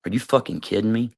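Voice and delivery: ordinary delivery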